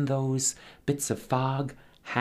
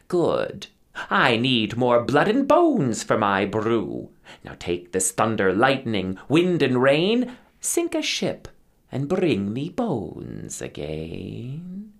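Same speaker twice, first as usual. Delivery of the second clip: put-on old river witch Mother Kronk demanding a shipwreck; cackling witch; 5 dB louder than usual. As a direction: play the witch greeting her imps with a cackle. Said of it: "with a cackle like dry lightning".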